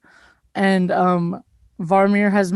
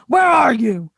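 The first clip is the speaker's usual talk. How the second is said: angry guard's shout, pitched up; guards yelling; 4 semitones higher, 4 dB louder, slower than usual. Acted. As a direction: call out angrily across a room. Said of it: "you hear guards yelling".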